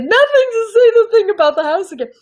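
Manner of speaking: high, loud, eager outburst; excited request. Retold or imitated. Producers begging the child for the line again, high excited urging. Delighted producers asking for a repeat.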